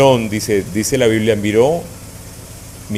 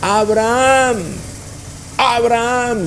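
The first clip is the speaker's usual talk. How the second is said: calling out; 10 semitones higher, slower than usual. Retold or imitated. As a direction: call his name from far above. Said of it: "le dijo a voces desde el cielo". (llamado a voces, alto y fuerte)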